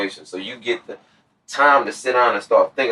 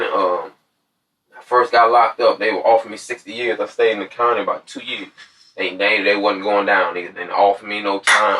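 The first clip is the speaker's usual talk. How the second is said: ordinary talk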